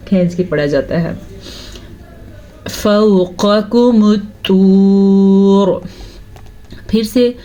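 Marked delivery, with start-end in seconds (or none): 2.7-5.8 slow, drawn-out tajweed recitation; Quranic recitation. Shown as a demonstration. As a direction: recite slowly and melodically. Recites verse. (धीमी तिलावत)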